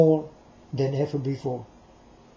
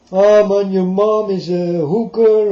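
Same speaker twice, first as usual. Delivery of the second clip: loud, higher jeering taunt; mock taunting insult; 4 semitones higher, 8 dB louder than usual. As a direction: hurl the insult like a heckler. Acts out an insult from others.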